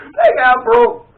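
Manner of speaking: helpless laughter breaking into a high squeal; real high, wheezy laughing fit; cracking up. Real, not acted. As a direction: laugh so hard the words come out as a shriek. Cracks up at own story.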